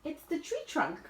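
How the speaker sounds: ordinary delivery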